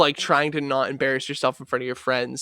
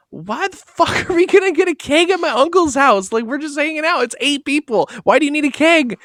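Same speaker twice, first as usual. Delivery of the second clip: high, loud panicked disbelief; freaking out; 10 semitones higher, 4 dB louder than usual. Retold or imitated. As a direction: sputter like you can't believe it. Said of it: "I was freaking out".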